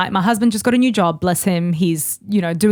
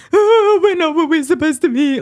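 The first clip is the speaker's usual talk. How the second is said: mock wail; 8 semitones higher than usual. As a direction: wail it, half-joking.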